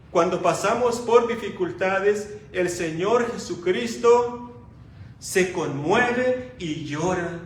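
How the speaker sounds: loud, fervent pulpit preaching; preaching at full force. Acted. Preacher to the congregation about Christ's love, loud fervent preaching. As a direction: proclaim it to the whole church.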